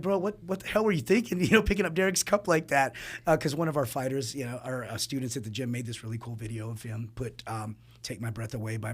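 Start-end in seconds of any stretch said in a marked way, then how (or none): 0.0-2.9 retold telling-off, higher and louder; mock incredulous scolding. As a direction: playfully take a friend to task, pitched up. Retells confronting fighter in elevator.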